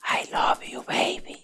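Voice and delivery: very soft, slow whisper; whispered in the ear. Retold or imitated. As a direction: whisper it like a secret sweet nothing. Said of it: "le digo al oído".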